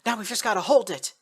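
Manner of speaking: determined call. Acted. Reply during a firefight.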